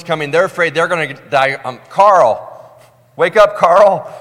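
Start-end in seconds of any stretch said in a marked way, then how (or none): 1.9-4.1 high, loud panicked shout; panicked wake-up call. Retold or imitated. Scared disciple yelling to wake a friend, high and loud. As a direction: shake him awake in terror.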